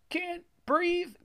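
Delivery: very high, strained moan. A narrator's reading. Greg trapped under the blob, moaning. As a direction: squeeze the words out while being crushed. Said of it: "Greg moaned".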